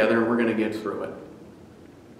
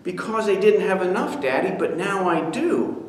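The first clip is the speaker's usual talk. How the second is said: put-on higher child's answer; little boy; 5 semitones higher than usual. Son answering his grumbling father, child voice. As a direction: explain it like a small boy.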